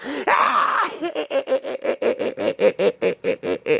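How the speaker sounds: long high crazed cackle; crazy laugh. Retold or imitated. Woman after threatening David, long crazy high cackle. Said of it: "started doing that crazy laugh".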